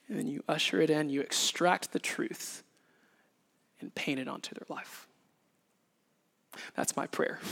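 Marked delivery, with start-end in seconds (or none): none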